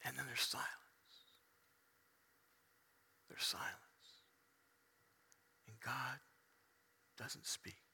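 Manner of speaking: very soft, low, with long pauses; hushed solemn preaching. Acted. Preacher telling congregation of Jesus on the cross, hushed. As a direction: let it sink into near silence.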